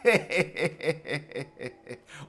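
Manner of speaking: "je je je" laugh on the E vowel. Shown as a demonstration. Preacher showing people who laugh with the letter E, "je je". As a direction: giggle through an E. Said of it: "se ríen con la letra E".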